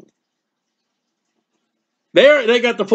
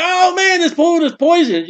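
high, shouted fake panic over the food; hammed-up shout of alarm, pitched up; mock alarmed shout; 7 semitones higher than usual. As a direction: yell it like a prank in a restaurant. Mock joke shouted in a restaurant.

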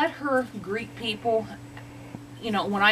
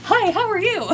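much higher and louder, bursting with excitement; a dog who can't contain herself; voicing the dog without her vest, wildly excited; overexcited dog voice; 12 semitones higher, 6 dB louder than usual. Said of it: "she's totally different".

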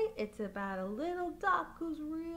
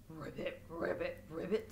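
low, croaky frog ribbits; 9 semitones lower, 5 dB quieter than usual. Acted. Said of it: "said the frog".

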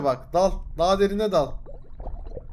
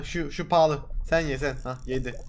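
ordinary talk

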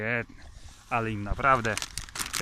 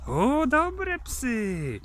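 praise; 11 semitones higher, 3 dB louder, much slower than usual. Real, not acted. Praising dogs during feeding.

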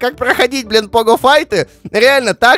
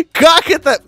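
normal talking voice

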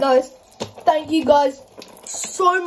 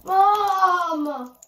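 calling out; 5 semitones higher than usual. Real, not acted. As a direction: shout for mom. Calling her mother.

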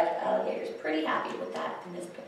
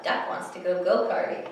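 ordinary delivery, nothing marked

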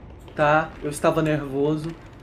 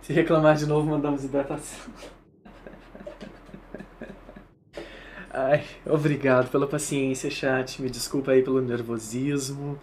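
low, softer sheepish apology; calm down and say sorry; quiet apology; 6 semitones lower, 7 dB quieter than usual. Real, not acted. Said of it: "fiquei um pouco nervoso". (pedido de desculpas baixo e envergonhado)

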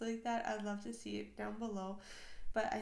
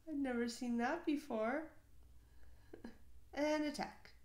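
usual speaking voice

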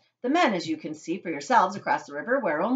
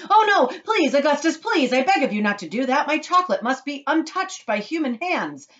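high, loud, panicked plea; crying out; 9 semitones higher, 6 dB louder than usual. A narrator's reading. Mister Wonka begging Augustus to stop, crying out. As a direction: beg urgently at raised pitch. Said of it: "he cried out".

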